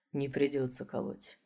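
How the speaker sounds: weary, soft low voice; tired. Acted. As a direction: say it tired and quiet. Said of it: "усталый голос".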